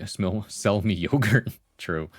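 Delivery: ordinary delivery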